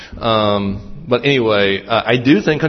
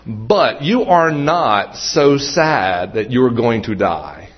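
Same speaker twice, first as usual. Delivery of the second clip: ordinary delivery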